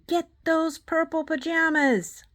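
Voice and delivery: high, loud read-aloud scream; storybook scream. A narrator's reading. Queen screaming after the fleeing boy, high and loud. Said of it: "Queen Dentina screaming".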